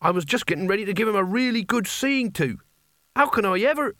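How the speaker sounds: put-on high-pitched, aggrieved young hero protesting; indignant would-be hero. Voices Nigel, indignant hero.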